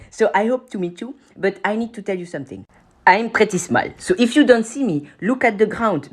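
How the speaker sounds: ordinary delivery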